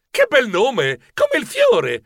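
esclamazione ammirata, voce che schizza in alto; complimento entusiasta. Acted. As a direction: fai un complimento acuto ed entusiasta. Complimento entusiasta nel racconto. (complimento esclamato con entusiasmo)

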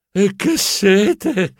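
slow, drawn-out thirsty sigh; slow parched moan. Acted. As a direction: stretch it out, dying of thirst. Thirsty after losing the pass.

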